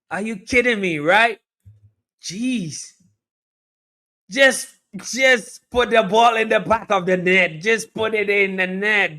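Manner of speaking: high, incredulous commentator outburst; exasperated disbelief. Real, not acted. Berating player after missed chance.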